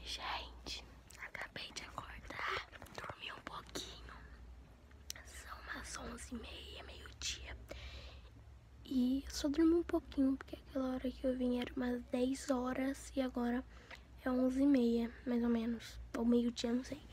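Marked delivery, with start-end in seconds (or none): 0.0-7.6 soft unvoiced whisper. Real, not acted. Just woke up, whispering. 10.4-13.6 quiet unvoiced whisper. Real, not acted. Still whispering after nap.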